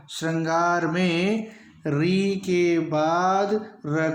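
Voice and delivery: श्रृंगार में री के बाद र dragged out slowly, word by word; teacher insisting on the rule; slow emphasis. Shown as a demonstration. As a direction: hammer the point home slowly. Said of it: "दोस्तों".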